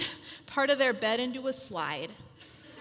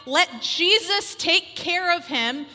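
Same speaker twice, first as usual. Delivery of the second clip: loud, high exhortation; rally the women to trust God; impassioned sermon push; fervent preaching; 4 semitones higher, 6 dB louder than usual. Acted. Preaching at full force.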